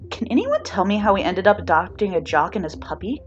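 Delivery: plain reading voice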